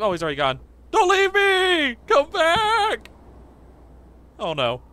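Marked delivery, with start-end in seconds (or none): 0.9-3.1 high, drawn-out loud wail; hammed-up abandoned cry, stretched long; desperate calling out. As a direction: holler after someone who left. Calling after departed buddy.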